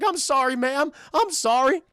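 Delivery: high, loud cartoon apology; apologetic Courage impression. Dog to rebuffed old woman, apologetic.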